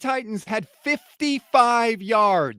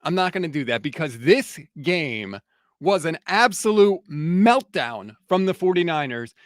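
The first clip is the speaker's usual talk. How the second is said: usual speaking voice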